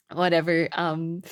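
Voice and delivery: ordinary talk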